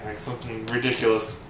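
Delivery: usual speaking voice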